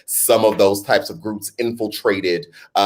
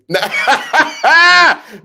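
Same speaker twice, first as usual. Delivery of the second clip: long loud high laugh; 7 dB louder than usual. Real, not acted. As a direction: break into laughter. Laughs at her show sign-off.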